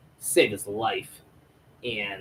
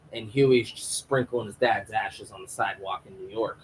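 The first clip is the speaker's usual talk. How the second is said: ordinary talk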